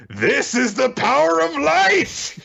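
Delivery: high, drawn-out battle cry; mock heroic shout. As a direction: yell it like a hero charging in. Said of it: "while shouting".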